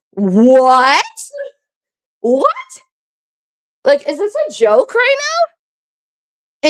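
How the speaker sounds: high, drawn-out incredulous questioning, with pauses. Retold or imitated. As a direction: re-enact being stunned by an accusation. Retelling her shocked reaction to accusation.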